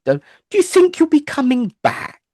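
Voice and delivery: quoted friend. Retold or imitated. Lynn asks him back.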